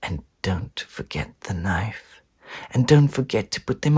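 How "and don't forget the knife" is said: much softer and slower; hushed. Acted. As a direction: keep your voice right down. Sneaking quietly.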